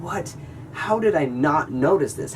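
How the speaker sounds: reliving the shock, voice jumping up; retelling finding the smashed window, alarmed; baffled panic. Retold or imitated. Retold panic at broken window.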